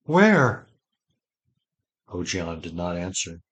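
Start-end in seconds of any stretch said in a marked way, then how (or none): 0.0-0.7 sudden high, loud cry of fear; terrified. A narrator's reading. Ged begging his master to say where, terrified. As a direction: blurt it out in terror. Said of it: "Ged said with terror in his voice".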